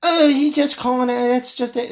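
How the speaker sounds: high put-on voice of a flustered spokesperson; hammed-up nervous excuse, high; mock press secretary covering for him.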